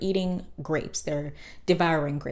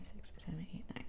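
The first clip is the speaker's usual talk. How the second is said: whispered counting; 17 dB quieter, faster than usual. Real, not acted. Narrator counting pregnancy months, whispered to herself. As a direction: whisper the numbers to yourself.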